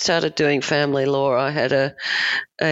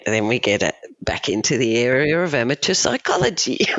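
ordinary talk, nothing marked

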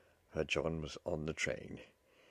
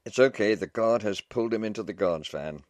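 loud, raised call with good news; relieved shout; 4 semitones higher, 9 dB louder than usual. A narrator's reading. Officer telling the wife her husband is aboard, shouted. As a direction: shout it from the doorway. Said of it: "A loud laugh and shout".

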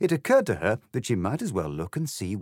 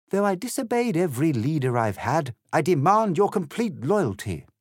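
high, loud, pompous demand; imperious party leader; 9 semitones higher, 6 dB louder than usual. Acted. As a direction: demand obedience, loud and pitched up.

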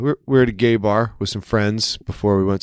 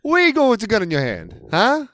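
mock Italian gangster; 10 semitones higher than usual. Quoting Hey Joe song mockingly.

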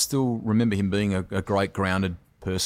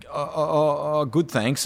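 Peter Brock; 7 semitones higher, slower than usual. Retold or imitated. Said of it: "I can hear him".

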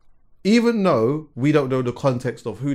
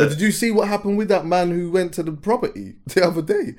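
laughing; 6 semitones higher than usual. Real, not acted. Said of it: "I need to say this without laughing".

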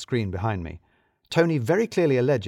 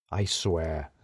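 low, weary oath, softer; exasperated grumble; 6 semitones lower, 3 dB quieter than usual. Acted. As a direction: sigh it out like a fed-up colleague.

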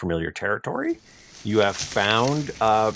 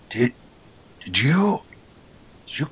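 stammering, breathy, pitched-up question; sputtering foiled villain; 6 semitones higher than usual. Acted. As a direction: stutter it out in disbelief. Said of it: "and he goes".

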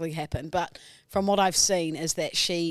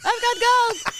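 much higher, louder mock Polish accent; Polish winner impression; 7 dB louder, slower than usual. Topping co-host's Polish Olympian impression, loud mock Polish accent. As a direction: crow it like a proud Polish champion. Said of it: "Polish Olympics winner".

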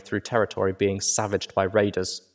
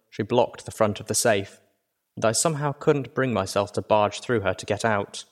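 ordinary delivery

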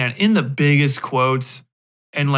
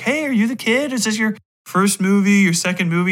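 higher, upbeat mock greeting; lightly voiced cheerful adult; friendly adult; 6 semitones higher than usual. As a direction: play the friendly grown-up meeting the kid. Adults greeting the kid actor.

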